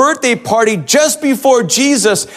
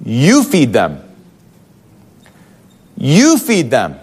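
firm; 6 semitones lower, slower than usual. Acted. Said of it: "he says it firmly".

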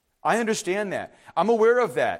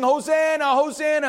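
higher, louder hosanna call; joyful cry; 6 semitones higher, 3 dB louder than usual. Retold or imitated. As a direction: call it out like the crowd. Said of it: "people praising".

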